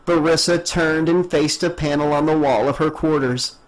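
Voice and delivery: plain reading voice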